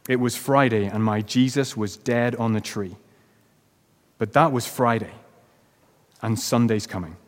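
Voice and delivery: usual speaking voice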